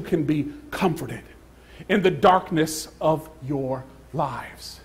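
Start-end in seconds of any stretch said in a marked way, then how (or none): none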